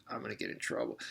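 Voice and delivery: hushed, breathy aside; soft worried whisper; whispered worry. Retold or imitated. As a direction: whisper it like a nervous kid. Friend's inner hesitation retold.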